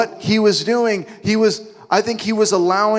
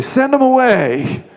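much higher, louder, slow mock complaint; mock disciple; 8 semitones higher, 5 dB louder than usual. Preacher mocking the disciples' favorite line, slow whiny. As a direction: dismiss them with a sing-song whine. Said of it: "he would just say".

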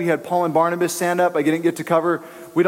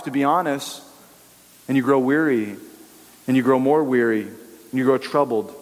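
ordinary talk

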